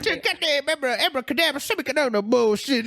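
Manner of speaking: high, silly incantation babble; hammed-up cartoon sorcery; mock spellcasting. Mock spell-casting in retold scene.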